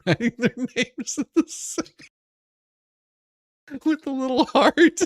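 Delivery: words broken by laughter; laughing, teasing. Real, not acted. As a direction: giggle through the line. Laughing while mocking the scene.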